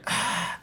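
a long unvoiced sigh; loud exhale. Giving up on the sandwich order, loud exasperated exhale. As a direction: let out a big frustrated breath. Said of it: "exhaled really loud".

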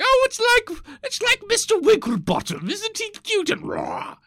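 very high, loud cooing falsetto; gushing old-timey Victorian. Acted. Old-timey visitor cooing over a lion, high gushing falsetto. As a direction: coo over a pet in a hammed-up high squeal. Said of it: "seeing a lion and they go like".

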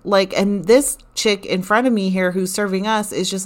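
ordinary talk, nothing marked

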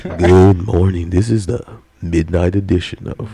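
smooth late night DJ intro; late night radio dj. Pretend. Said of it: "You sound like a late night radio host".

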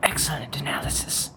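quick ASMR whisper. Acted. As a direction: breathe a quick compliment. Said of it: "A S M R".

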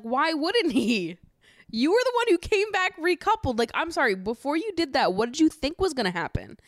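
high-pitched, indignant challenge; exasperated disbelief. Acted. Recapper challenging Tanya who came back recoupled, exasperated disbelief. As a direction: demand an answer, pitch riding high.

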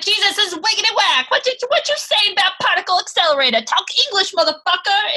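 high, fast, exaggerated street slang; mocking slang caricature. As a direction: ham up a cartoonish slang-talking sidekick.

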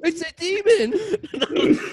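high, loud, slow laughing cry; laughing scream. Real, not acted. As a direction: panic through laughter. Boy panics laughing.

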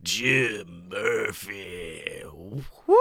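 low, slow, rasping sick drawl. Acted. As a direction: stretch out the name in a sick radio voice. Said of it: "I got that good sick voice".

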